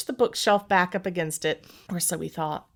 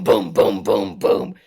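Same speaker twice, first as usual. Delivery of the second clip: booming thuds; 13 semitones lower, 5 dB louder, slower than usual. Retold or imitated. Imitating heavy thumps overhead.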